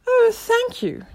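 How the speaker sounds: very high, slow squeaky thanks; tiny creature touched by kindness; grateful caterpillar. A narrator's reading. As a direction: squeak out gratitude.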